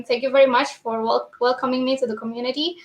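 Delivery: hurried, fast closing words; rushed lightning talk. Real, not acted. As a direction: rush through the sign-off. Closing rush at talk's end.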